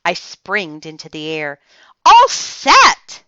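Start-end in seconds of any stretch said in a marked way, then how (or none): 2.0-3.2 very high, loud joyful shout; squealing joyful little girl; joyful holler. A narrator's reading. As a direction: holler it with pure joy. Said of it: "I hollered, real joyful".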